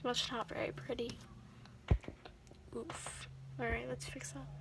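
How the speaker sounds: soft unvoiced whisper; ASMR whisper. Real, not acted. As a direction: breathe the words close to the mic. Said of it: "A plus A S M R".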